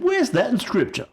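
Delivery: high-pitched, indignant challenge; mock-outraged question; challenging. Acts out foolish challenge.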